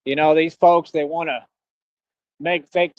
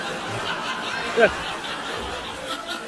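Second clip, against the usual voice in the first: breathy laugh; 10 dB quieter than usual. Real, not acted. Laughs after his rant.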